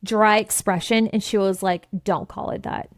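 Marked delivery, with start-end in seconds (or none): 2.0-2.9 soft, deadpan and emotionless; blank and dry. Retold or imitated. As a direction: say it with a completely blank face. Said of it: "the most blank, dry expression".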